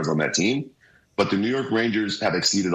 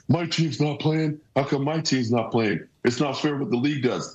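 higher-pitched imitation of griping fans; mock complaining; 7 semitones higher than usual. Analyst imitating hockey fans left out of outdoor games, mock complaining. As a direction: whine it like a fan feeling left out. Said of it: "people were complaining".

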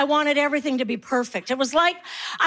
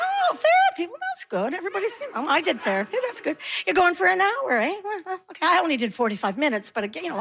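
feigned nonchalance; 4 semitones higher, faster than usual. Acted. Mother prying about child's therapy, feigned nonchalance. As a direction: pretend not to be nosy, pitched up then dropping away. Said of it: "pretend like you're not nosy".

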